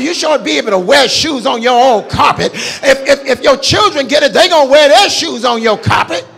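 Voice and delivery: loud, high, driving preacher shout; full-force preaching. Acted. Pastor urging the congregation to pray, full-force preaching. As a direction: whoop it to the rafters. Exhortation rising to shouting peak.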